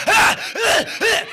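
loud, high rhythmic grunting; hammed-up high yelps; mock humping grunts. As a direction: grunt like a wild rock star.

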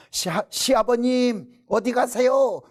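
high, slow, sugary greeting; mock politeness. Wife out the car window sweetly greeting the dog as father-in-law, mock polite.

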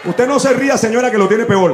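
loud teasing call-out at an audience member; jokey yell to a laughing woman in the crowd; playful shout. Real, not acted. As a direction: shout it at the lady who's laughing.

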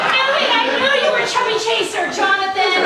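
high, loud, fast triumphant yell; excited shout. Acted. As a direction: shriek it out in triumph. Accusing lover in improv scene.

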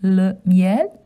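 le miel as luh myel, rising; French pronunciation. Shown as a demonstration. Asking children to repeat le miel. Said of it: "Can you say le miel?".

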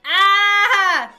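loud, high scream of fright. Real, not acted. Player hit by a jump scare, screaming.

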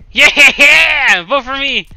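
loud, laughing celebration; excited cheer. Real, not acted. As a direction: whoop it and laugh into the plea. Cheering, asking for votes.

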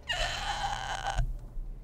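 long breathy sigh. Real, not acted. Streamer reacts to the crashed game, long sigh. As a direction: let out a long, quiet sigh.

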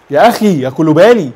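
loud furious cry at the player; angry shout. Real, not acted. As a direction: shout it out in real anger. Angry at defender's mistake.

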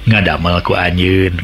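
plain reading voice

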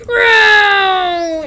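high, loud wailing whine; airplane noise. Acted. Airplane swooping into battle, loud high whine. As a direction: imitate a diving plane.

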